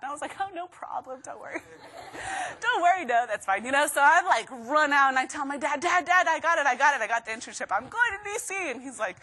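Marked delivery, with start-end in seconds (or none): 1.7-2.6 breathy unvoiced laugh. Real, not acted. Laughing at own reply. 2.6-3.6 laughing. Retold or imitated. Retells the reply laughing. 5.8-8.7 excited news. Retold or imitated. Telling dad she got it.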